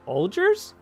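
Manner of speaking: quiet, puzzled rising question; unsure guess. Retold or imitated. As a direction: guess the brand, half-asking. Sniffing the commander's coffee.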